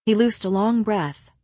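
ordinary delivery, nothing marked

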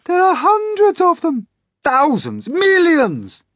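very high, loud alarm; shrieking in horror; panicked cry. A narrator's reading. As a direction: cry out each number in rising panic. Terrified count of the cloud men.

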